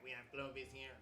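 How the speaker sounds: soft, put-on character voice; hushed, hammed-up game character; Clovis. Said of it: "that's how Clovis talks".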